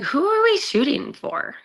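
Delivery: a model asking the crew who the shoot is for, high and baffled; baffled question. Retold or imitated. High, bewildered question.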